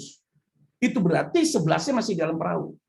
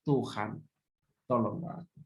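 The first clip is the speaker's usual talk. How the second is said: low, soft prayer for help; hushed plea; 10 semitones lower, 8 dB quieter than usual. Shown as a demonstration. Preacher showing the congregation a quiet plea to God. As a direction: murmur it to God under your breath. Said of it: "kita harus teriak".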